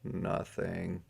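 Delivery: a soft muttered nothing; softly, barely out; quiet. Acted. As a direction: mutter it under the breath. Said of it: "said in a quiet voice".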